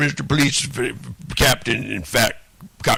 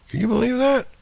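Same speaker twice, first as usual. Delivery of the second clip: much higher, in a different put-on voice; 14 semitones higher, faster than usual. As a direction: squeak it out in a silly high voice. Sudden put-on voice.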